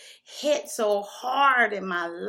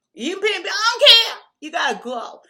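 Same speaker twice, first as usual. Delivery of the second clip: high, loud order to leave; angry yell; 10 semitones higher, 12 dB louder than usual. Retold or imitated. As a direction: throw him out. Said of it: "I said".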